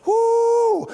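high ringing woo of delight; joyful whoop. Real, not acted. Preacher whoops to the congregation, high joyful whoop. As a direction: let out a high celebratory whoop. Preacher exclaims after scripture point.

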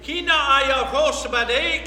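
usual speaking voice

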